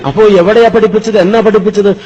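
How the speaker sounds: put-on old teacher curious about his former pupil; eager questioning. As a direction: press him eagerly.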